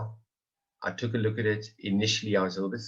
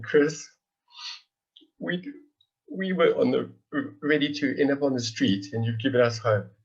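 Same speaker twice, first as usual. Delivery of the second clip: choked up, higher, halting with long pauses; emotional; 3 semitones higher, 3 dB louder than usual. Real, not acted. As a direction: overcome with gratitude, struggling to get it out. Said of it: "just feeling a bit emotional this evening".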